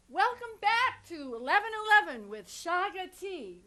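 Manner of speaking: high, put-on British accent, not quite landing; playful stab at a British accent; British accent attempt. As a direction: try on a British accent for the co-host. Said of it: "[name] has a little British accent".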